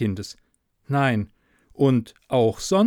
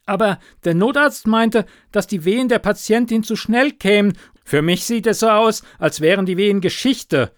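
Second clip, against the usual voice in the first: loud, high, overriding voice; interrupting loudly; 9 semitones higher, 6 dB louder than usual. A narrator's reading. Superior interrupting the officer, loud and high. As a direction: cut him off and talk over him. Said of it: "fuhr der Vorgesetzte dem Beamten ins Wort".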